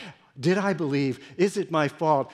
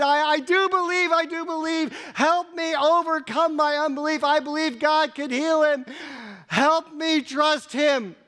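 anguished plea; 13 semitones higher, 4 dB louder than usual. Acted. Father cries out his faith.

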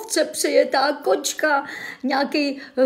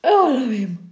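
mock sob; 2 semitones lower, 3 dB louder than usual. Mock crying over a trivial police report. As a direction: fake crying, voice cracking.

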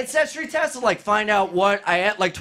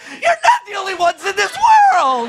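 loud, very high comic shriek; panicked high voice; 9 semitones higher, 6 dB louder than usual. Acted. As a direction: confront them in a squeal that shoots up octaves. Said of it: "my voice raises, like, thirty octaves".

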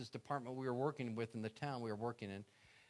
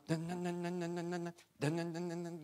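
imitated engine crank; 4 semitones higher than usual. Cranking a dead van engine.

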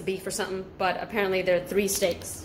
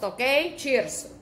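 ordinary talk, nothing marked

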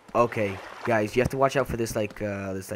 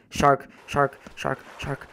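higher, urgent repeated warning; panicked alarm; 5 semitones higher, 4 dB louder, slower than usual. Real, not acted. Player panics as a shark attacks, alarmed. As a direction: blurt it out in real panic.

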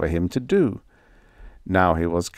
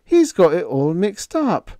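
protesting; 8 semitones higher than usual. A narrator's reading. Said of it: "Higgins protested".

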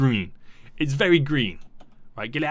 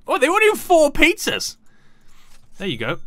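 ordinary talk, nothing marked

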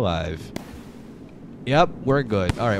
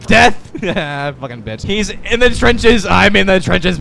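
ordinary talk

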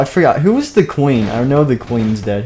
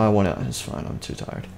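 softer, fading tired mumble; 3 semitones lower, 9 dB quieter than usual. Acted. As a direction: trail off wearily to nothing. Character giving up.

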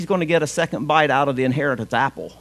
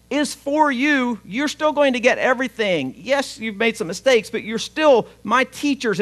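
higher, pleading and tender; earnest Jesus; 7 semitones higher than usual. Acted. Jesus assures the scholars and Pharisees, higher and sincere. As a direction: open your heart to them.

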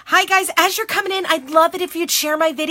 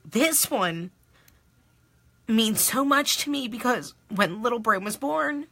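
choked up, long halting pauses; real tearful; 5 dB quieter than usual. Real, not acted. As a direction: fight back tears, voice dropping away. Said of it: "I can't believe I'm crying".

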